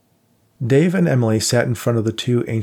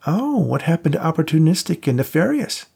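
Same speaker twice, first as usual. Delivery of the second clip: a hint of a higher, teasing pal; lightly voiced buddy; 7 semitones higher, 3 dB louder than usual. A narrator's reading. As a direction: play the cocky friend lightly. Voiced friend Max.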